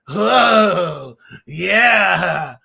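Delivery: loud, drawn-out dopey surfer. Retold or imitated. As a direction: play it like a spaced-out surfer dude. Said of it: "that surfer voice".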